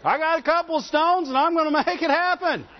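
a proud king brushing off the priests; arrogant king. Acted. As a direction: puff yourself up as the mighty Uzziah. Uzziah voiced again, mocking pride.

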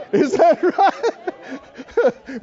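a long, knowing, high-pitched challenge; drawn-out teasing question. Acted. Preacher teasing the congregation, drawn-out knowing question. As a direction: stretch it out, high and slow, teasing.

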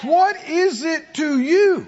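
high, slow, drawn-out demand; forceful challenge. Real, not acted. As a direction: press the congregation for an answer. Preacher challenging the congregation.

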